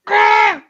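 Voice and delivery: very high, loud squeal; acted-out high shriek; panicked yelp. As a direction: let out a high mock scream of panic. His panicked reaction to being singled out.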